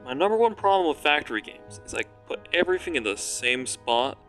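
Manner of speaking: ordinary talk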